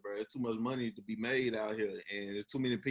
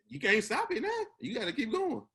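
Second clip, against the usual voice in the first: high, louder urging to oneself; acted pep talk; 12 semitones higher, 4 dB louder than usual. Podcaster voicing his push to finish school, urgent pep talk. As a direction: pitch jumps up, insistent mock urgency.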